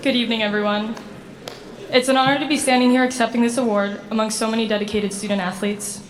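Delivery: ordinary talk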